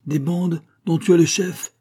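high-pitched accusing exclamation; indignant outcry. A narrator's reading. Abbot accuses Pitou of leading bandits, crying out. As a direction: cry it out as an accusation. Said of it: "s'écria l'abbé".

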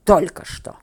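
loud growled reply; angry, forceful growl. A narrator's reading. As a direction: growl it out firmly. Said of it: "прорычал Синеглазый".

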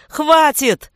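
high, loud scream. A narrator's reading. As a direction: scream in agony. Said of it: "Вопль вырвал последний воздух из лёгких".

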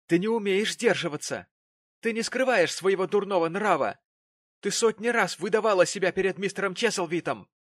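wrathful father telling off his daughter; furious. A narrator's reading. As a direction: lash out in angry accusation. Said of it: "на гневное, если не прямо яростное".

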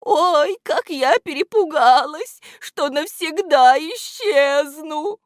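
high, loud, breathless relief; радостное волнение. A narrator's reading. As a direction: exclaim as if rushing to friends. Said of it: "радостно кидаясь навстречу своим подружкам". (высоко, взволнованно и радостно)

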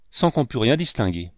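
usual speaking voice

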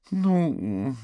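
high, wavering woman's reply; hesitating. A narrator's reading. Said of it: "она заколебалась". (нерешительно, высоким голосом)

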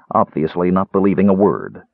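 ordinary delivery

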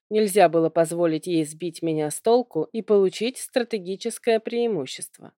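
ordinary delivery

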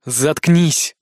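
usual speaking voice

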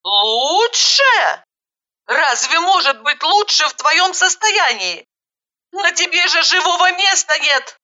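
high-pitched disbelieving protest; incredulous alarm. Acted. Woman looking at the battered girl, incredulous.

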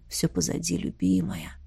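hushed, tender soothing whisper; soft whisper. A narrator's reading. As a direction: soothe her gently, barely voiced. Antares soothing her.

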